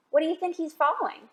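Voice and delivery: ordinary delivery, nothing marked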